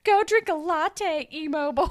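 loud, high jeer breaking into laughter; hammed-up insult, amused by it; mock taunt. Friend acting out one rival insulting the other, loud mock jeer.

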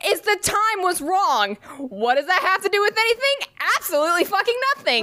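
high-pitched, worked-up venting; exasperated rant. Real, not acted. Telling a friend about the wrong clock, exasperated. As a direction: vent in a high, incredulous pitch.

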